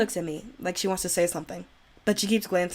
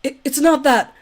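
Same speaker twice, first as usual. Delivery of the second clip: sudden louder blurt of denial; flustered denial; 7 semitones higher, 8 dB louder than usual. Acted. Yuri blurts out her denial to Monika, flustered and louder. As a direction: blurt it out defensively.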